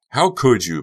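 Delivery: loud strained scream. Acted. Becca screams at Honey, loud strained cry. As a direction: strain your throat on it. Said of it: "Becca screamed, straining her throat".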